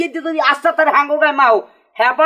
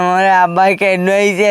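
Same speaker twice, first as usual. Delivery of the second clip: crying; 7 semitones lower than usual. Acted. Beaten son walking off and lamenting about his father, crying. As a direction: wail it out self-pityingly. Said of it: "কান্দে কান্দে যাও কই?".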